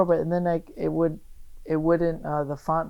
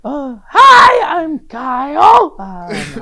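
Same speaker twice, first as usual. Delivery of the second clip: much higher, louder, strained squeaky yelp; imitating his stage voice; 11 semitones higher, 14 dB louder, much slower than usual. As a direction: screech the name out slowly, cracking. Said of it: "it was the voice that he does".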